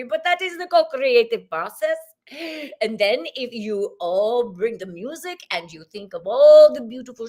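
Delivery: beam out as a cheerful cosmic guide; channeled Zach. Acted. Channeled spirit greeting the women, jolly and exuberant. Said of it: "welcome to Zach".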